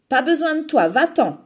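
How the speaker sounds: loud, high dismissal; cry out. Acted. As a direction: shoo him away. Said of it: "s'écrie Emma la rebelle".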